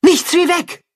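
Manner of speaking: high, panicked call to flee; frightened cry. A narrator's reading. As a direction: shout the alarm in fright. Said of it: "ruft die Wolfsmutter erschrocken".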